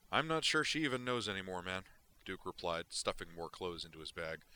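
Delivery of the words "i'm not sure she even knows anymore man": big, wired, careless delivery; Duke's voice. Acted. Duke brushes off Zoner's question, loud and wired.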